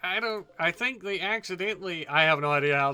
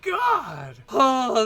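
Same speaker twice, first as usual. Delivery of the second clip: high, loud laughing cry of amazement; excited shouting; 8 semitones higher, 7 dB louder, much slower than usual. Real, not acted. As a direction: yell and laugh at the blast. The fusion warhead explodes.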